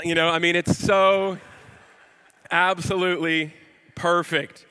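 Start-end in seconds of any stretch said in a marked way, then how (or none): none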